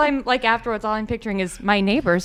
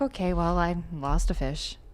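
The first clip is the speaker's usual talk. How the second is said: soft, lowered, deflated delivery; resigned; 4 semitones lower, 8 dB quieter than usual. Retold or imitated. Pond owner accepting the lost fish, quietly resigned. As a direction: drop the voice and give up.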